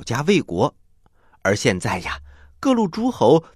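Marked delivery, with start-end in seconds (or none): none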